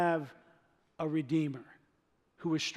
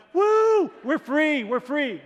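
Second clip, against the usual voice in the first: high, loud whoop of freedom; whooping taunt; 8 semitones higher, 8 dB louder, much slower than usual. Retold or imitated. Freed Israelite taunting the Egyptians, loud whooping cheer. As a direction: crow it at the enemy. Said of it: "mooning them".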